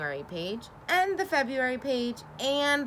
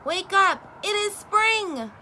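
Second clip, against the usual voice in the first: loud, much higher, slower at the end; shouting; 10 semitones higher, 5 dB louder than usual. A narrator's reading. Frog shouting for Toad to wake up. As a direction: call him out of winter sleep. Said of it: "shouted Frog".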